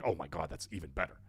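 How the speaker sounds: ordinary talk, nothing marked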